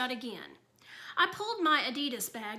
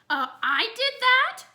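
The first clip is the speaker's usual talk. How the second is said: much higher and louder, eager little voice; delighted kid; 12 semitones higher, 8 dB louder than usual. A narrator's reading. Toddler hearing of his own mess, eager and squeaky.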